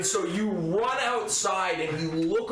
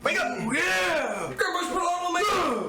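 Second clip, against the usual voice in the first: shouting; 11 semitones higher than usual. Acted. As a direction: yell to rouse sleeping friends. Player shouts to wake party.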